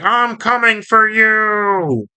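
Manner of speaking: high, drawn-out villain threat; Starscream. Retold or imitated. Starscream threatens Optimus, high, slow and drawn out. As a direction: stretch it out slowly in a high cartoon voice. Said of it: "And he's like".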